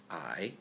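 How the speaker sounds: I dipping low then rising, third tone. Shown as a demonstration. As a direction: drop I low and let it curl up. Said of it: "For tones".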